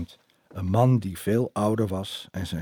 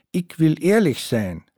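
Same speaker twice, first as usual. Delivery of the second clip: put-on high, slow German-accented Dutch, louder; 8 semitones higher, 5 dB louder, slower than usual. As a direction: play the German boyfriend charming her. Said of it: "zei Detlef".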